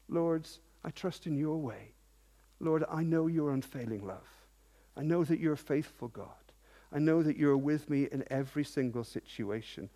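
softly spoken, sincere prayer; quiet, reverent address to God; trusting prayer. Acted. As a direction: hush down into humble trust.

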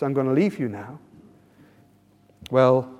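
usual speaking voice

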